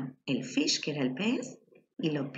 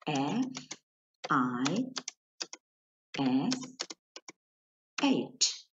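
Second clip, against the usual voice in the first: 1 spelling out letters; much slower than usual. Shown as a demonstration.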